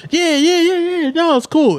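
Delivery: high, breezy and dismissive; imitating the friends' careless yeah yeah; mock carefree friends. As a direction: wave the worry away. Friends brushing off worry.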